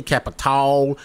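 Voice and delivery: Capital said as "kappa-TALL"; variant pronunciation. Shown as a demonstration. Offering viewers another version of the word, variant pronunciation. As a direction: end Capital on a drawn "tall" sound. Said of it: "however you wanna pronounce it".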